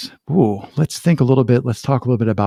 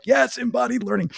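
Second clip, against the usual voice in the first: mock enthusiasm; 13 semitones higher than usual. Host voices fellow embodied-learning fans, high mock excitement. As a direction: squeal it like an eager fan club. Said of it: "we're all like".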